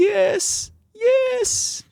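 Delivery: very high, wailed mock sobbing; imitated tearful yes. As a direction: wail it high like someone crying as he agrees. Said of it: "cried more and more and more".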